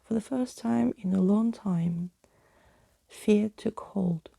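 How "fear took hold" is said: ordinary delivery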